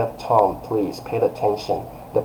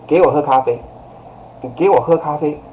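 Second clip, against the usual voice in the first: higher, forceful command; direct order to kid; 5 semitones higher, faster than usual. Shown as a demonstration. Parent telling a kid to drink coffee, strict. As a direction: order your child, quite direct. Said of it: "maybe to your kid like this".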